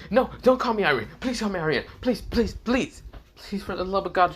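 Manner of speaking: high, pleading voice, begging over and over; frantic high-pitched begging; desperate pleading. Acted. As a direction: beg like it's life or death. Irina begs not to be called Irene.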